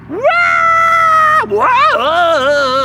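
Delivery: loud high yelp then whoa; troll's shocked cry. Acted. Troll knocked off the bridge, loud yelp. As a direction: cry out as you fly off.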